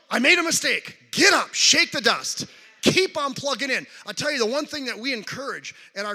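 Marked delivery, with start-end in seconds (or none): 0.1-3.9 rally yourself after a slip, loudly; rousing self-command. Retold or imitated. Preacher acts out getting over a mistake, loud and rousing. Acting out a pep talk to himself.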